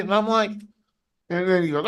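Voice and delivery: usual speaking voice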